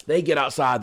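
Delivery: ordinary talk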